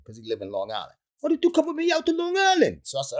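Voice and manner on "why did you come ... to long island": fast, high, put-on buddy voice; high comic impression of a friend; mock high friend voice. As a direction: rattle it off in a squeaky imitation. Friend repeats question.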